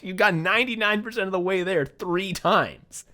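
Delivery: usual speaking voice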